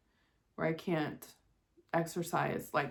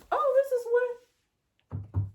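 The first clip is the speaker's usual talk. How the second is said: pleased surprise; 3 dB louder, much slower than usual. Real, not acted. Finds wood to knock on.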